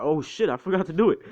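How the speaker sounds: loud, high, quick outburst of dismay; dismayed exclamation. Retold or imitated. Retelling All Might remembering what he forgot, dismayed exclamation. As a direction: blurt it out as a sudden realization.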